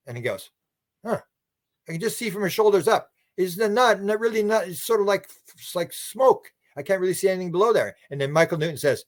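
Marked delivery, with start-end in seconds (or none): 1.0-8.0 puzzled peering. Retold or imitated. Preacher peers at the figure.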